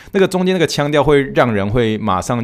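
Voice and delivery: ordinary delivery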